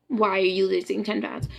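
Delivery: normal talking voice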